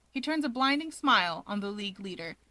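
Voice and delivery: plain reading voice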